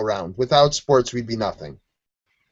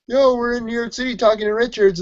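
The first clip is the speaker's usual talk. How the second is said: put-on voice, pitched much higher; a daughter blurting out team news, pitched much higher; mock excited daughter; 9 semitones higher, faster than usual. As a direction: gush it like a giddy fan spilling a secret. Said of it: "one of the daughters was like".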